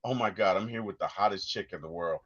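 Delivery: low, soft, quick inner thought; caught up, smitten. Retold or imitated. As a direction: marvel privately under your breath. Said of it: "I'd be so caught".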